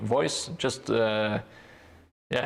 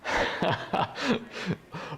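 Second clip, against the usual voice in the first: high airy laugh at a viewer's comment; breathy laugh; 8 semitones higher, 3 dB quieter than usual. Real, not acted. Laughing at the chat's joke, an airy high laugh. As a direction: let a soft airy laugh slip out.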